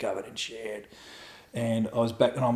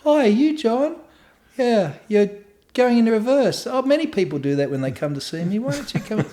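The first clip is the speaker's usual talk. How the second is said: high-pitched grandma chatter; put-on old lady voice; little old lady; 10 semitones higher than usual. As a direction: play a helpful granny, voice pitched up. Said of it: "this little old grey-haired lady comes".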